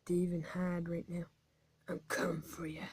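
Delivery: low unvoiced hunter's whisper; sneaky whisper. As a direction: whisper as if stalking prey. Sneaking up on other players.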